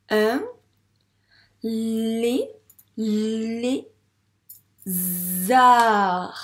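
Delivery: long held lll in lé, buzzing zzz in zard; loud, stretched syllables with pauses; sounding out. Shown as a demonstration. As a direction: decode un lézard slowly, piece by piece. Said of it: "On corrige?".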